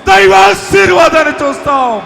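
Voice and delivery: preacher proclaiming abundance to the crowd, shouting; preaching at full force. Acted. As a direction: shout the victory to the hall. Climactic proclamation continues.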